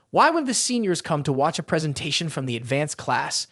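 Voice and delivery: usual speaking voice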